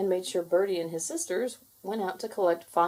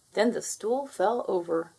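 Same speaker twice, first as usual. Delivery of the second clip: ordinary delivery, nothing marked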